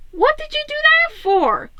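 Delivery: high, sharp alarmed question; high startled Leo. Acted. Leo asking Raph why he swerved, high and alarmed. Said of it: "Leo asked".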